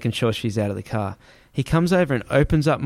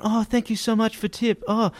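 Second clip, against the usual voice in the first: much higher, gushing mock driver voice; grateful driver; 10 semitones higher than usual. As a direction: imitate the driver thanking you, high and effusive.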